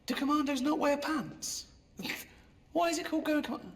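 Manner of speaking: high, incredulous questioning; puzzled and disbelieving; mock bafflement.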